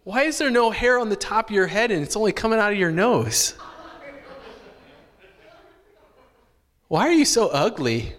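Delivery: put-on a small child's puzzled questions at grandpa; little kid asking. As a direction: ask it like a blunt five-year-old. Child blurting rude questions at grandpa.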